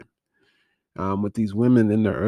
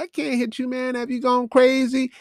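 much higher, shocked protest; mock disbelief at a crazy request; incredulous driver; 12 semitones higher than usual. Retelling the driver refusing to hit him, incredulous and pitched high.

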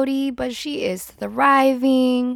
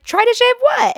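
much higher and louder in disbelief; shocked question; 6 dB louder than usual. Retold or imitated. Daughter asking her mom what she shaved, high and shocked. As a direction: squeal it in disbelief.

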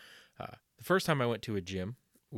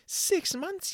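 mock outrage; 3 dB louder than usual. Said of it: "outraged echo in ad".

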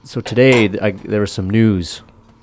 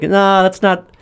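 much higher squeaky refusal; hammed-up kid voice, higher and louder; 11 semitones higher, 4 dB louder than usual. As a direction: pitch right up like a scared little boy. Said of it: "Kid's like".

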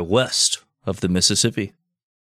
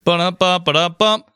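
high sung drum-fill sound; mock rimshot; 8 semitones higher, 3 dB louder than usual. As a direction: hum a rimshot after the punchline. Rimshot after a joke.

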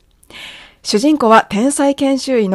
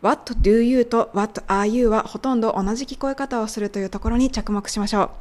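normal talking voice

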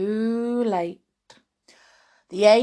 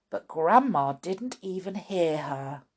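ordinary delivery

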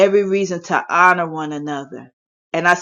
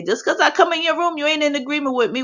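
high, quick, softer mock-objector voice; mimicking objectors; 8 semitones higher, 4 dB quieter, faster than usual. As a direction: imitate a challenger in a raised, rapid pitch. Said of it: "there's some people who say".

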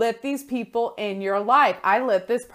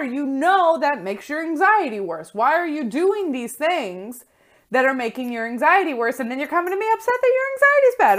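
higher, exasperated scolding, building in intensity; tough-love yelling; 6 semitones higher, 4 dB louder than usual. Acted. Friend scolding an anxious friend over self-care, exasperated mock yell. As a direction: lay into your friend like you care. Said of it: "they'll just yell at you and be like".

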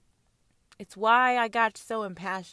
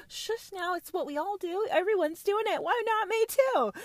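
high mocking voice; 10 semitones higher than usual. Higher voice, excuse-making queen.

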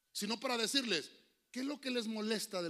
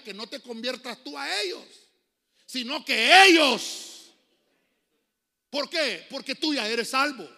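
slow, much louder proclamation; loud preaching; 5 semitones higher, 15 dB louder than usual. Real, not acted. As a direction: ring it out to the hall. Preaching climax. (proclamación en voz muy alta)

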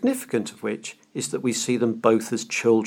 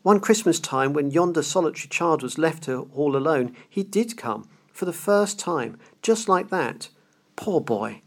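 put-on higher, excited old man lost in memory; ecstatic Scrooge; 5 semitones higher than usual. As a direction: gush like a delighted child. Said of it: "Scrooge exclaimed in ecstasy".

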